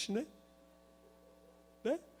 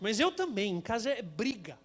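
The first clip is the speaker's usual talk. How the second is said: ordinary delivery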